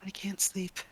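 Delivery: whisper. Acted. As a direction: breathe the words out barely audible. Fiend answers in a whisper.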